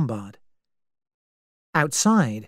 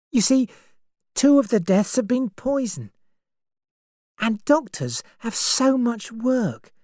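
put-on higher young woman's voice, uneasy; frightened young woman; 10 semitones higher than usual. A narrator's reading. Vera asks Lombard who the killer is, uneasy. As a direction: voice Vera as scared and unsettled.

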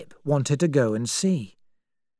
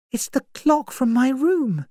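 put-on higher, anxious young woman; young woman's voice; 11 semitones higher than usual. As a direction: voice Vera as uneasy and searching. Vera's discovery in the story.

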